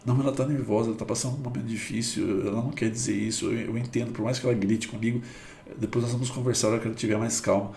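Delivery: soft, fast, patient reassurance; calm understanding. Retold or imitated. Person who values the shouting differently, softly calm and understanding. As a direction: talk yourself down kindly and quickly. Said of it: "olha a emoção nessa pessoa que valorizou dessa forma".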